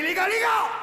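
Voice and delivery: high-pitched, urgent call to the lads; urgent alert. Acted. Male spider rushing back to his mates, urgent alert. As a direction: shout out breaking news to your mates. Mâle essoufflé alerte ses copains.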